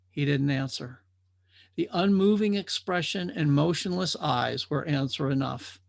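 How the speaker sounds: ordinary delivery, nothing marked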